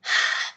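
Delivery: short, loud audible breath; deep breath. Shown as a demonstration. Therapist breathing along with a client, audible sigh.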